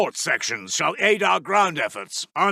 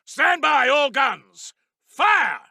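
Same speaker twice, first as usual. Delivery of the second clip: shouted fire command; 5 semitones higher, slower than usual. Acted. Artillery command called out.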